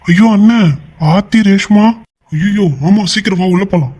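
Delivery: high, urgent cry of dismay; panicked alarm. Acted. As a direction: raise the alarm in a fluster. Henchman finds door open, panics. (பதறிப்போய் உயர்ந்த குரலில்)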